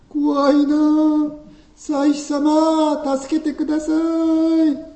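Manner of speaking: high, slow, trembling plea for help; frightened. Acted.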